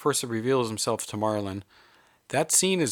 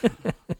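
sudden high-pitched laugh; a quick high laugh; 13 semitones higher than usual. Real, not acted. Laugh after joke.

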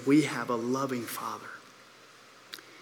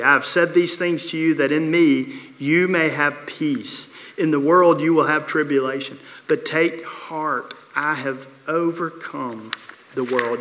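louder, proclaiming the verse aloud; scripture reading; 6 dB louder than usual. Acted. Pastor reading Jesus' words to the church, proclaiming. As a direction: read it like holy words to the congregation.

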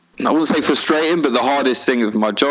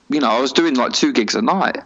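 ordinary delivery

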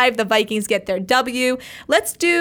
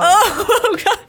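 laughing; 9 semitones higher, 4 dB louder than usual. Real, not acted. Hosts giggling at the start.

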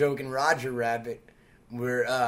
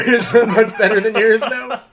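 high, loud drawling Stewart impression; mock Jimmy Stewart; 7 semitones higher, 11 dB louder than usual. Teasing his friend, a loud high Jimmy Stewart. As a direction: show off a better Stewart. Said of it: "my Jimmy Stewart".

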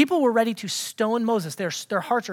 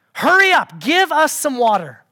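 loud, raised-pitch demand; 5 semitones higher, 6 dB louder than usual. Acted. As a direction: order it impatiently, at once.

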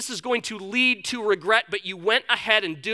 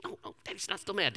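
whispered shushing, much softer; hushed whisper; 12 dB quieter than usual. Retold or imitated. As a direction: hush the child quickly. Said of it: "I'm like".

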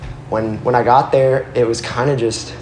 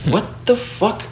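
high, softer mock disbelief; retold indignant disbelief; 9 semitones higher, 5 dB quieter, slower than usual. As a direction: retell a stunned "what the", pitched up. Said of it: "and I was instantly mad".

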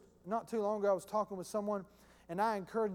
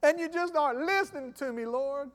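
whiny complainer; 5 semitones higher, 4 dB louder than usual. Retold or imitated. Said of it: "that's a lot like you used to be".

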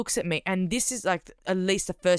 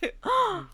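sharp high gasp of shock; imitated shocked gasp. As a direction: gasp like you've been caught out. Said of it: "And they went".

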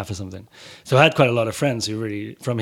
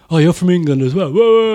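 mock excited tourist; 7 semitones higher, 9 dB louder than usual. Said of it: "the last thing I wanna hear is".

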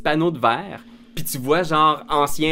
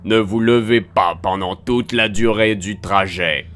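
slow, loud automated announcement; metallic announcer voice; 3 semitones lower, 6 dB louder, slower than usual. Acted. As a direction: annonce robotique lente et forte. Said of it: "voix métallique qui résonne dans le wagon". (voix métallique d'annonce)

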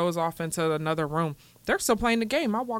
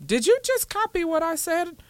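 put-on indignant kid; 10 semitones higher than usual. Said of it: "He goes".

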